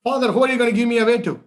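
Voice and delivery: put-on a boy pushing his father hard; insistent. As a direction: press the question hard and quick. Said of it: "He goes back".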